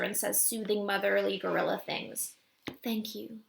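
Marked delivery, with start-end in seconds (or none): 2.8-3.4 barely voiced, mouthed thanks. A narrator's reading. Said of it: "Jody mouths to me".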